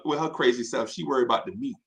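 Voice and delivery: ordinary talk, nothing marked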